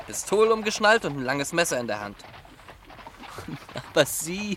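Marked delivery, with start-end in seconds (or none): 3.1-3.9 soft laugh. Acted. Son laughs at his own picture.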